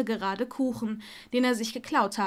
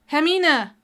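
higher, loud call of a name; raised interrupting shout; 5 semitones higher, 8 dB louder than usual. Acted. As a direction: call to get her attention. Friend interrupts, calling her name.

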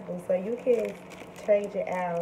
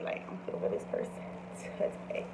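breathy near-whisper aside; 8 dB quieter than usual. Real, not acted. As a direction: whisper it to the camera. Showing purse, voice drops.

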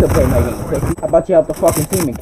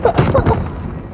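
high breathy laugh. Real, not acted. Reacting to insult in voice chat.

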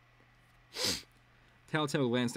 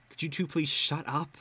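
growled; 6 semitones higher than usual. A narrator's reading. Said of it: "I growled".

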